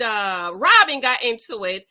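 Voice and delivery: ordinary talk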